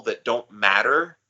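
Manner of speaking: usual speaking voice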